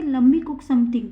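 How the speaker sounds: "let me" blurred into "lemme", slow; lemme contraction. Shown as a demonstration. Teacher gives the contracted spoken form. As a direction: run "let me" together as "lemme". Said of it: "contraction में कैसे बोलेंगे?".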